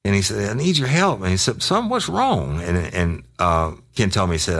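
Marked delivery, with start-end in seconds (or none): 0.5-1.2 high-pitched imitation of begging; mock tearful plea. Kid begging his police contact for help, mock tearful. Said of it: "Motherfucker was crying". 1.6-2.6 very high, drawn-out query; imitated concerned question. Police officer asking a crying informant, high imitated concern.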